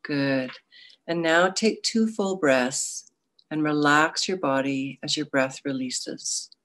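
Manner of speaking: guided meditation. Acted.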